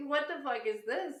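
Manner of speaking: high-pitched mock demand, laughing. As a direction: ask it high and half laughing, pretending to be outraged.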